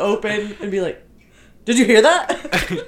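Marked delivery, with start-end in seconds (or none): 1.7-2.9 loud, high, drawn-out boast; mock showing off. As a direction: call it out slowly so everyone notices. Acting out showing off childhood hiccups.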